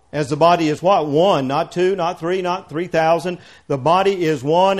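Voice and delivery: loud, driving preacher's reading; fiery scripture reading. Acted. Preacher reading Corinthians to the congregation, booming. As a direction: hammer home every "one". Reads scripture at full force.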